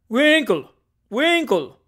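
high, loud summons; shouting. Acted. Tupman rousing his friend, shouting. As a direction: holler a name into another room. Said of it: "shouted Mister Tupman, calling into the inner room".

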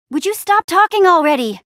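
loud, high exasperated outburst. Acted. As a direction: cut them off, totally fed up. Fed up with Stella's talking.

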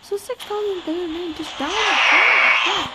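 loud, slow cry of dismay. Real, not acted. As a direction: wail it slowly at losing the resources. Game loss dismay.

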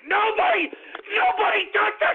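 sudden enraged yell, high-pitched; furious shout. Real, not acted. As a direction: explode into high, loud screaming. Explodes from brooding into rage.

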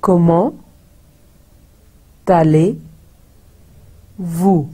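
Comment with silent t, allez-vous stretched slowly; French pronunciation. Shown as a demonstration. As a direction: drill Comment, then a slow drawn allez-vous. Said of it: "उसका टी नहीं हम बोलते".

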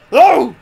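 loud, high burst of "oh"; startled yell. Real, not acted. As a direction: yelp as the monster hits. Startled yell during boss fight.

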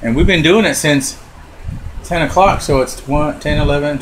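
ordinary talk, nothing marked

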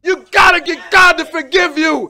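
rising, shouted exhortation; preaching at full force. Acted. Preacher pressing the congregation to seek forgiveness, shouting. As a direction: drive the warning home loud.

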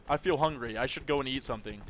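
ordinary talk

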